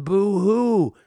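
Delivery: loud, high mock wail; hammed-up boo-hoo cry; mock sobbing. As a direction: burst into exaggerated fake tears. Acts out bursting into tears.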